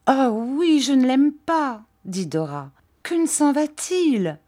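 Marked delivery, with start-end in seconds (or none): 0.1-1.8 put-on high, childish sweetheart voice; high-voiced girlish objection; petulant Dora. A narrator's reading. 3.0-4.4 very high, complaining sweetheart; high-pitched pouty complaint; petulant Dora. A narrator's reading.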